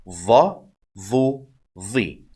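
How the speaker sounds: heavy, dark voiced th in "Dha, dhu, dhi"; emphatic dha. Shown as a demonstration. Teacher drills the dha for learners, heavy emphatic th. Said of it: "the dha is more emphatic".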